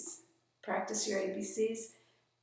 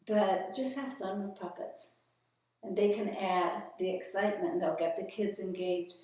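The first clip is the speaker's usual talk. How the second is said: ordinary talk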